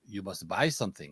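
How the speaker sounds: low, soft mock French accent; bad Paris accent. Shop owner refusing a traveler the restroom, bad French accent. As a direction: put on a rough French accent, quietly. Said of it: "that was probably a bad Paris, uh, accent".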